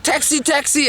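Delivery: high, urgent acted call for a cab; frantic hailing. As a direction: call out desperately to a passing car. Naked man hailing a cab.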